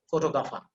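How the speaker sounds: photographer without any final R. Shown as a demonstration. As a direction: end photographer on an open "a". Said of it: "There is no R".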